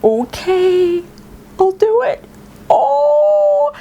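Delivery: high-pitched, drawn-out delighted squeal; giddy giving in. Retold or imitated. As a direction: give in as if bursting with glee. Gives in with barely contained delight.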